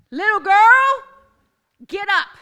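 high, loud, ringing command; proclaiming shout. Acted. Preacher shouting the command over the congregation. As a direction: belt it to the whole church.

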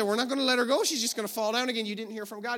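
high-pitched retold protest; indignant refusal. Two helpers refusing the healer's order, protesting.

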